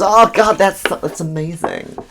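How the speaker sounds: high, gushing imitation; hammed-up, high-pitched swooning; gushing woman impression. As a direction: imitate a smitten American caller. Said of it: "She goes".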